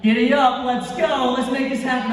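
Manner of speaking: loud, high, raring to go; pumped-up eagerness. Pretend. Dreamer psyching himself up before starting, excited. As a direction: cheer yourself on at the start.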